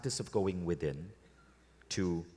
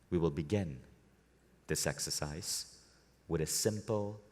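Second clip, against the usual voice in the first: guided meditation. Acted. Leading a room into a relaxed state, guided meditation. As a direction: lead them inward, unhurried and measured.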